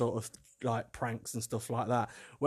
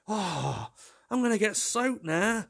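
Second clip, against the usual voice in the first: lightly voiced exasperated little girl, pitched up; frustrated child; 7 semitones higher, slower than usual. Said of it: "she was getting highly frustrated".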